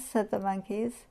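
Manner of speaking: usual speaking voice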